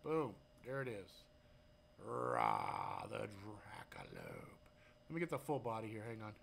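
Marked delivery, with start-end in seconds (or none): 2.0-4.5 low, slow monster announcer; dragon roar voice. Pretend. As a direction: growl it like a dragon. Presenting the dragon creature.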